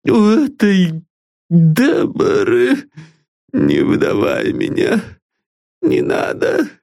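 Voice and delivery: high, broken, pleading voice; a wretched man begging not to be betrayed; despairing old man. Acted. Desperate master begs his servant not to betray him.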